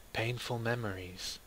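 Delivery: plain reading voice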